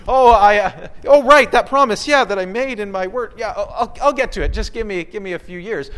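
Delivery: hammed-up forgetful promise-maker fumbling for an excuse; mock stalling. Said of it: "deferring his promises and saying".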